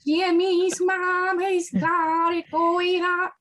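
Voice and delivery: high, slow cartoon impression; atrocious Carl Weezer. Fan doing a Carl Weezer cosplay bit, atrocious impression. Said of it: "My Carl Weezer impression is atrocious".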